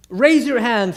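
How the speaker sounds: rally the room to raise hands; passionate preacher call. Retold or imitated.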